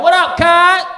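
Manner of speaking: loud, high gangster greeting; Crip greeting. Retold or imitated. Compton gang member greeting a stranger, loud and high. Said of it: "That's how they do".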